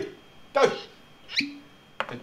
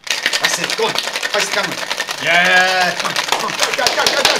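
loud rallying shouts; excited cheering; 6 semitones higher, 11 dB louder, slower than usual. Real, not acted. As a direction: hype them up to shake harder. Cheering on the shaking.

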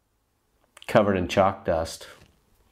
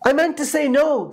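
high, rushed goose voice backpedalling; teacher frantically fixing his slip; hurried correction. A narrator's reading. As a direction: take it back in a panic. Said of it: "as fast as he could".

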